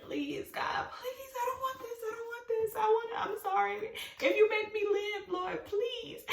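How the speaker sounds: high, thin begging to God; desperate pleading. Retold or imitated. Storyteller re-enacting her panicked bargain with God, desperate pleading. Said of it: "I'm just talking to myself".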